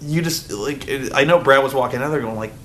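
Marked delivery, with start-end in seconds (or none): none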